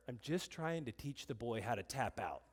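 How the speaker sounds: low, soft, subdued reply; quiet self-defense. Retold or imitated. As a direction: drop right down, explain it meekly. Calm reply to upset wife in retold argument.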